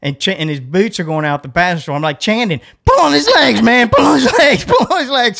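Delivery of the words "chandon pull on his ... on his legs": high, loud, repeated orders; urgent shout. Retold or imitated. Rescuer ordering his friend to help, shouting urgently. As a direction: holler it over and over, pitched up.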